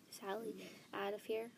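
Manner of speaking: plain reading voice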